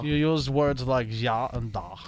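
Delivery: slow mock Russian; comic, measured Russian voice; Russian accent. Shown as a demonstration. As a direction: spell it out in a put-on Russian accent. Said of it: "very good Russian accent".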